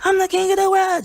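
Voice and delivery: high-pitched mock crowing; obnoxious boasting. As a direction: imitate an obnoxious show-off. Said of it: "really obnoxious".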